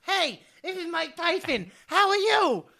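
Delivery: Mike Tyson impression. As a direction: do Mike Tyson's high, lisping voice. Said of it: "till he talks".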